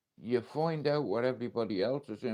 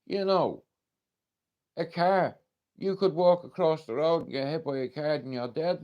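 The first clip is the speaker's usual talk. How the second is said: retold blunt woman; 5 semitones higher, 5 dB louder than usual. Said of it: "She said".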